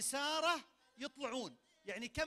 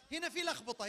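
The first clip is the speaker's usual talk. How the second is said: normal talking voice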